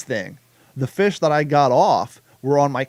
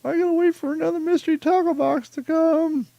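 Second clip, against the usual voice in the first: much higher, whiny put-on voice; mock whine; 12 semitones higher than usual. Host imitating a robbed fisherman, high mock whine. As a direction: whine like a hapless victim.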